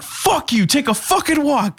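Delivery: high, slowed yelling right in his face; furious shout. Retold or imitated. Retelling screaming at the drunk guy trying to open the car door. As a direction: scream at him to take a walk. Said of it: "yelled at somebody like this".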